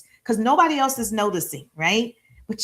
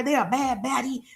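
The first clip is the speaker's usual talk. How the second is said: ordinary talk, nothing marked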